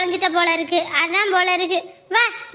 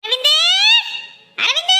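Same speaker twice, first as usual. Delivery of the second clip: calling out; 9 semitones higher than usual. Acted. Mother calling her son from far, rising call. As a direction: call the boy from a distance.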